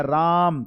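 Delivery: राम drawn out on one long vowel; लंबी पुकार. Shown as a demonstration. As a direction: stretch the आ in राम like a long call. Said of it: "लंबा पुकारा जा रहा है". (राम को लंबा खींचकर)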